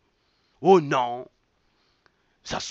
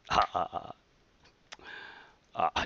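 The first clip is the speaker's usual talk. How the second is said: scornful laugh; 11 semitones lower, 8 dB quieter than usual. Real, not acted. Laughing at the leaders' tricks, low scornful laugh.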